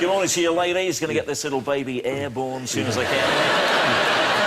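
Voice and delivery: chirpy pop-radio pilot, laughing through it; trendy DJ pilot. Retold or imitated. Mocking modern airline pilots as chirpy radio DJs. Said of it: "those guys sound like they're on Radio Top Shop".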